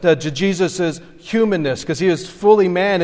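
usual speaking voice

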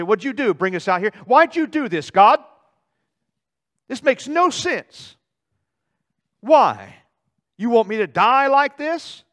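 loud, high, furious demands; shouting at God. Acted. Israel tempting God in the desert, shouting furiously. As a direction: yell your accusation straight at heaven.

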